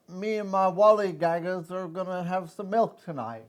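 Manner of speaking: slow Clockwork Orange cockney British; cliche British accent. Pretend. Doing the cliche British accent for co-hosts, slow and hammy. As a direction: ham it up like a droog. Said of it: "You gotta start with the cliche accent".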